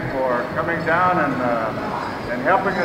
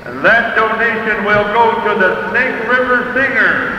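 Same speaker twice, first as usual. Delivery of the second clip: slow, measured arena announcer; powwow emcee; 5 semitones higher than usual. Real, not acted. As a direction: call out names to the crowd.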